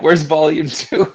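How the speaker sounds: drawn-out mock fan question through a laugh; baffled fans. Bandmate imagining fans missing volume two, mock bafflement. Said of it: "People be like".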